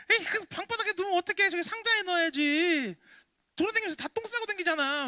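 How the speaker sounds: high, loud, fast mock scolding mom; scolding mother. Voicing mother scolding about chick mess on floor, high mock nag. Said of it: "그러면서 막 뭐라고 하셔요".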